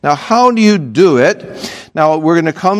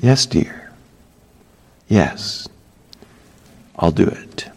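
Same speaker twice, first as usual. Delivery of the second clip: soft, lower, humble yes; meek yielding; 8 semitones lower, 6 dB quieter than usual. Retold or imitated. Preacher models a wife answering as to the Lord, soft and meek. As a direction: give in gently and softly. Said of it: "as you would respond to your Lord".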